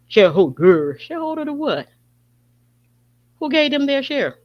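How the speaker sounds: high, scornful Shareholder; mocking disbelief. Real, not acted. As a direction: toss the word back in outraged disbelief. Scoffing at the word shareholder.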